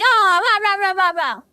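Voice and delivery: loud mock grumbling in nonsense syllables; hammed-up coworker grouching; grumpy ranting. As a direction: burst out in wordless griping. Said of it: "he's all grumpy too".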